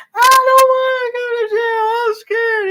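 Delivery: high, loud, fake crying wail; mock screaming man. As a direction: wail it like a scared man being arrested.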